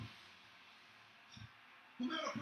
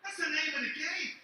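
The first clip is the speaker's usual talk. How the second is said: loud, hyped crowd roar; big wrestling-promo yell; fired-up shout; 3 semitones higher, 6 dB louder than usual. Real, not acted. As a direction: pump up the arena, loud. Firing up the crowd.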